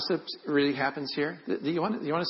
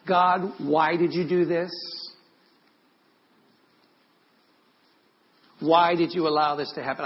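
raised, loud demand of God; anguished cry to God; 3 semitones higher, 5 dB louder than usual. Retold or imitated. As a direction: cry out in protest, short of screaming. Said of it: "honestly screaming at God".

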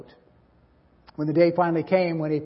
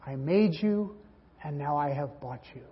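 tender joy; 2 semitones lower, 7 dB quieter than usual. Acted. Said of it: "the joy of the Godhead".

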